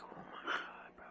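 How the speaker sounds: whispered disbelief under the breath; exasperated whisper. Retold or imitated. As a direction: mutter it to yourself, barely audible.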